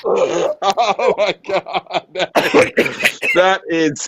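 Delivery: high-pitched, slow, cracking up with laughter; helpless laughter. Real, not acted. Host reacting to a disgusting challenge, helpless laughter. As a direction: laugh helplessly through the words.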